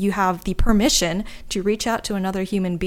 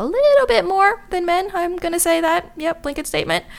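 playful high aside; 11 semitones higher, 4 dB louder than usual. Acted. Self-aware aside on stereotype.

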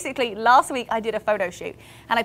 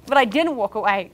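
normal talking voice

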